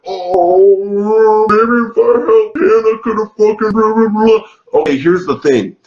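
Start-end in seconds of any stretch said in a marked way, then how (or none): 0.1-4.8 mocking Clay. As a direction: sneer at him with a put-on wimpy voice. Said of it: "he's just a dumbass who fucking is like".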